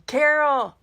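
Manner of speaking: loud high call of a name; husband calling for his wife, loud and high. Retold or imitated. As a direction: yell the name across heaven.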